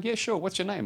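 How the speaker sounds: high-voiced retold staff reply; breezy gatekeeper. Acts sanatorium staff answering at door.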